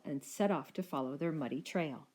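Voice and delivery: usual speaking voice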